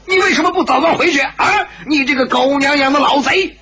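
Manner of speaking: high-pitched angry tirade; furious. A narrator's reading. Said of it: "丁不四不由大怒". (大怒，高声痛骂)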